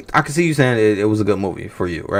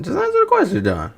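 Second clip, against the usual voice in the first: high, exasperated plea; fed-up demand pitched way up. Real, not acted. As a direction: squeak it out in frustration.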